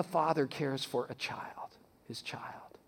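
hushed whisper of a father's care. Real, not acted. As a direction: whisper it tenderly, barely voiced. Tender metaphor of fatherly care.

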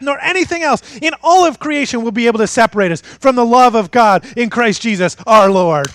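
loud, high, building proclamation; fired up. Acted. Preacher reading Paul's assurance like a coach's speech, fired up. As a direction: belt it out like a coach rousing the team. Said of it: "without getting fired up".

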